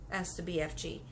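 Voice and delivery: ordinary delivery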